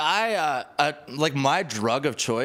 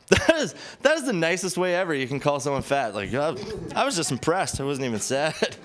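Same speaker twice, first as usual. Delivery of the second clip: usual speaking voice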